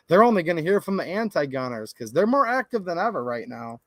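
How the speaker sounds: ordinary talk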